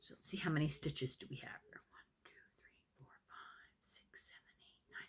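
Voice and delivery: barely audible whispered count; hushed, private counting that fades almost to nothing; counting under breath. Real, not acted. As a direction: whisper to yourself as you count stitches. Counting stitches to herself.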